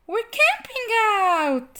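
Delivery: put-on high, slow delighted child; high happy child voice; happy announcement. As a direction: announce it proudly like a happy girl. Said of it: "Bonnie announced happily".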